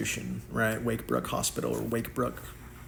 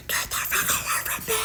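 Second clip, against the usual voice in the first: shrieking. Retold or imitated. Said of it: "I would start shrieking".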